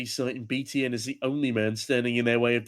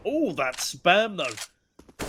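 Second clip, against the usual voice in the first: excited; 5 semitones higher, much slower than usual. Real, not acted. Caster marvels at a spray.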